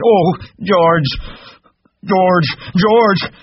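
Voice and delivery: high, desperate shout for help; crying out. Acted. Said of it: "And he cried".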